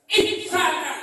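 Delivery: Ihsana called out high and loud, falling; loud proclaimed word. Shown as a demonstration. Preacher calling out the key word to the hall, loud. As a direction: ring out Ihsana to the crowd. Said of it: "dan terhadap kedua orang tua".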